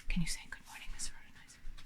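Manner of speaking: hushed whispered greeting. Shown as a demonstration. Demo of whispering among five voices.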